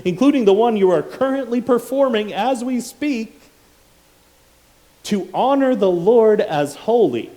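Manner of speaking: loud, high, fiery preaching; preaching at full force. Acted. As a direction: thunder it from the pulpit.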